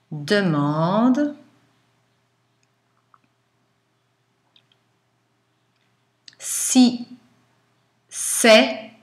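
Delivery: dictating. Shown as a demonstration. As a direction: dictate word by word, slowly.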